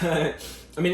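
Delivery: usual speaking voice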